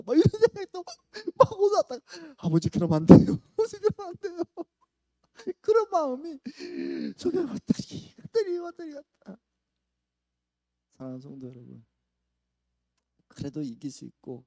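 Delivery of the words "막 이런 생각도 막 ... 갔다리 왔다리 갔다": loud, high, impassioned preaching; full-force preaching. Real, not acted. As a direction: pour it out from the pulpit, louder and higher.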